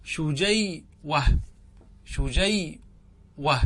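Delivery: شجيوة drawn out very slowly, every syllable stretched; slow spelling-out. Shown as a demonstration. Teacher to students, showing the original form شجيوة, very slowly. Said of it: "وأصله".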